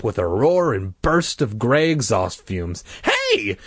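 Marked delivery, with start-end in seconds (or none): 3.0-3.6 very high angry scream. A narrator's reading. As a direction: yell at the bus driver. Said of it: "I screamed".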